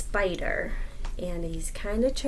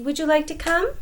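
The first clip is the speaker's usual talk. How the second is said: higher coaxing question; sly invitation; 8 semitones higher, 3 dB louder than usual. Acted. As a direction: tempt the lion. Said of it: "said Anansi".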